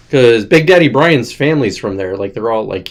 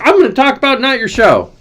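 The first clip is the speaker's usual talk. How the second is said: mock blah voice; 11 semitones higher than usual. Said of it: "With, like, the most blah in the world".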